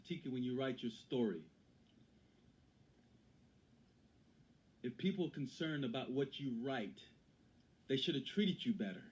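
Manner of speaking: lightly voiced quote from a mentor; Viola. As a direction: deliver it with a hint of her voice, measured pauses. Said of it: "I'm gonna do it in my Viola voice".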